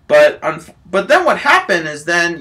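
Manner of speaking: ordinary delivery, nothing marked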